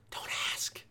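hushed, voiceless whisper; whispered plea. Real, not acted. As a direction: whisper it as emphatic advice. Urging people not to ask.

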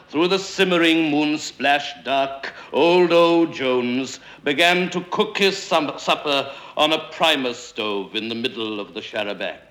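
plain reading voice

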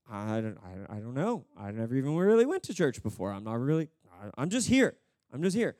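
low, halting thief voice; bewildered thief. Acted. Thief admitting he never went to church, bewildered. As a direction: fumble for an answer, lost. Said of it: "the thief is just like".

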